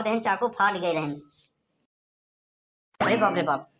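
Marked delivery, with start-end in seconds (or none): 3.0-3.7 frightened gasp. Acted. Learning the boss stabbed someone.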